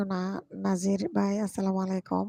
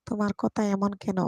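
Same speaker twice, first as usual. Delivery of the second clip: ordinary delivery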